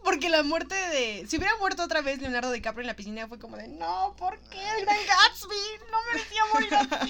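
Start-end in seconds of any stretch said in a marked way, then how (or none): 3.7-7.1 high, hammed-up wail of grief; mock lament. Podcaster mimics fans mourning DiCaprio, hammed-up lament. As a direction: overplay the outrage.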